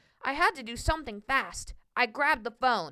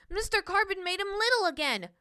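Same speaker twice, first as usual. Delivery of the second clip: ordinary delivery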